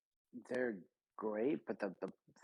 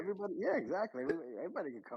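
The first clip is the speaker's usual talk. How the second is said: high mock villain; 9 semitones higher, much faster than usual. Villain bit continues.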